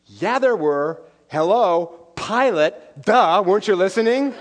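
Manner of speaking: a hint of a smug little boy; six-year-old Noah. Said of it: "And Noah said".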